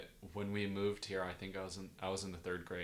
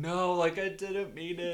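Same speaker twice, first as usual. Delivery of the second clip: high, loud retold protest; mock-indignant denial; acted defensive protest; 10 semitones higher, 7 dB louder than usual. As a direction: reenact pleading innocence, pitched way up. Acted protest to teasing sister.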